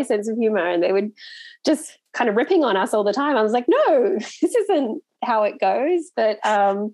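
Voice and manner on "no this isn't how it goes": higher, drawn-out mock objection with a laugh; mock protest. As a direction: protest slowly, half laughing. Retold protest, laughing through it.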